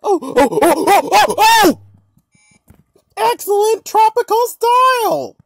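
loud rising oh oh oh; excited. Acted. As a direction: burst out in excitement. Excited answer outburst.

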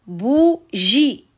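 "Bougie" split by a pause, bou-gie; syllable by syllable. Shown as a demonstration. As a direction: say "Bougie" in two long, separate beats. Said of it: "Je découpe les mots de la fiche en syllabes". (« Bougie » découpé en syllabes, bou-gie)

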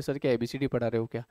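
lightly voiced student, low and quick; student's question. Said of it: "बोले". (छात्र का धीमा, दबा-सा सवाल)

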